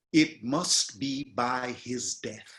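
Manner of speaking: slow, each word weighed; slowly spaced, one syllable at a time; slow monosyllables. Shown as a demonstration. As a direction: lay down every single syllable. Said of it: "listen to all the monosyllabic".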